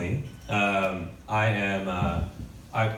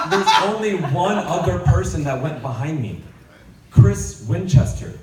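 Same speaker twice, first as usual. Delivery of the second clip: louder, slower, higher ranting; mock rant; 9 semitones higher, 10 dB louder, slower than usual. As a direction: build a slow indignant rant. Ranting about last name.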